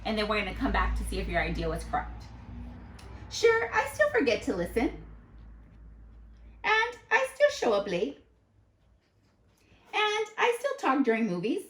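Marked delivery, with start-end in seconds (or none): 3.3-11.6 much higher, sing-song confession; playful high-pitched admission; sheepish character voice. Acted. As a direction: own up lightly like a cartoon kid. Character admits faults, voiced high.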